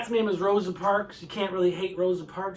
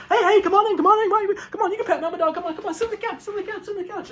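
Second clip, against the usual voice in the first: high-pitched, gushing dog voice; squeaky-high, repetitive eager babble; excited dog host; 11 semitones higher, 5 dB louder, much faster than usual. Acted. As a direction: welcome guests like an overexcited dog. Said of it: "the dog's like".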